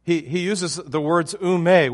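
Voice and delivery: usual speaking voice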